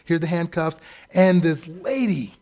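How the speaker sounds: normal talking voice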